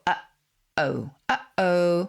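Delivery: "Uh-oh" split by a clear stop in the throat; "uh-oh" with the airflow cut between syllables; glottal stop demo. Shown as a demonstration. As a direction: slowly show the catch in the middle of "Uh-oh". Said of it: "making that sort of stop of airflow".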